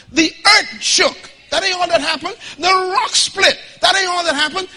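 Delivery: loud, high, slow preaching cry; preaching at full force. Acted. Preacher rousing the church, high and loud. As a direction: build the congregation up, shouting.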